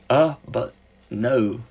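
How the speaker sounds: slow, hesitant "Uh, but no"; unhurried and reflective; slowly. Acted. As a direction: draw it out as if weighing each word. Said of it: "he said slowly".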